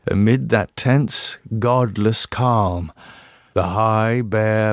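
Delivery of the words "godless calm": usual speaking voice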